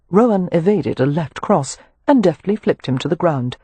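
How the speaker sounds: plain reading voice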